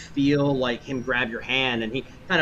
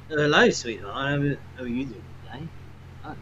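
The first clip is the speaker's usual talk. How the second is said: a hint of a smarmy drunk blocking her path; sleazy dockside gentleman; 2 semitones higher than usual. Gentleman stopping Aradia at the dock, mildly smarmy voice. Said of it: "this gentleman kinda steps in front of you and goes".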